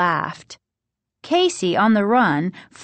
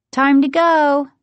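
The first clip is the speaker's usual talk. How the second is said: high, louder call across the yard; 8 semitones higher, 6 dB louder than usual. A narrator's reading. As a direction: call a brother over to leave. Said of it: "Margaret called to Casey".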